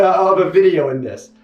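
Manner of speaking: ordinary delivery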